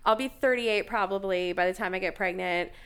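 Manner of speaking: usual speaking voice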